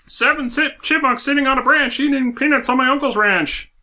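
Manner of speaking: chirpy high cartoon voice; high silly chipmunk voice. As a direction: squeak it out like a chipmunk.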